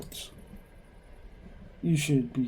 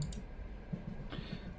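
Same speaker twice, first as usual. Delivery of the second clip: weary sigh; 12 dB quieter than usual. Acted. Daughter sighs after mom hangs up.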